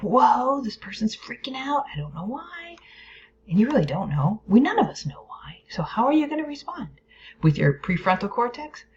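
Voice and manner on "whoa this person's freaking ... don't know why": high-pitched whoa, fading to hushed puzzlement; mock bewilderment. Reacting inwardly to a friend freaking out, high, puzzled voice. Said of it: "where you're like".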